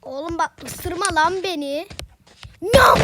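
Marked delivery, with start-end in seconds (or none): 2.6-3.0 playful biting sound; a big mock bite, loud; loud chomp noise. Karakter ısırmaya çalışıyor. (yüksek sesle ısırma sesi)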